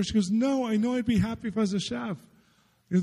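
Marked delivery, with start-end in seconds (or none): 0.3-2.2 high-pitched imitation of the girl; mimicked, excited girl's reply; retold eager certainty. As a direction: insist excitedly in her higher voice.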